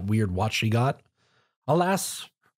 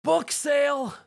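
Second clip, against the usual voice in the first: high shouted call, much higher pitch; yelled out; 14 semitones higher, slower than usual. A narrator's reading. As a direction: yell it to snap her out of a daydream. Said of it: "inhaled deeply and yelled out".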